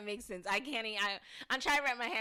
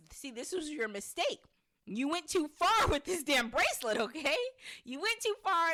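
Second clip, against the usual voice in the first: accusing outburst; 8 semitones higher, 7 dB louder than usual. Retold or imitated. Tabitha blames Henry in retelling.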